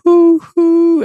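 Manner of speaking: high loud hoo hoo like a distant train; loud high train hoot; train whistle imitation. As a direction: mimic a steam whistle. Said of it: "she heard".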